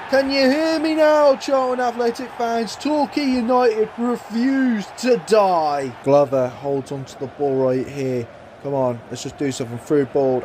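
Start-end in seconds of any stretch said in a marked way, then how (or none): 0.1-5.9 triumphant taunt. Acted. Commentator taunting rival fans after the equaliser, triumphant.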